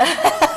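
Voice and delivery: short high bright laugh at her own joke; burst of laughter. Real, not acted. Host laughing at her own tough question. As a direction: laugh out loud at the irony.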